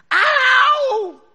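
long high yelled ow; acted pained howl at full volume; loud cry of pain. Kid scraping his foot in the canyon, yelling. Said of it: "and he goes".

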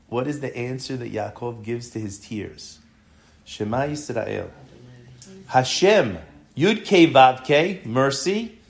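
ordinary delivery

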